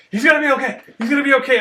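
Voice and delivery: high, loud imitation of an excited medic; hasty insistence. Doc behind him at bloody scene, insisting excitedly.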